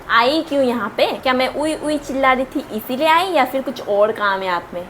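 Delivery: usual speaking voice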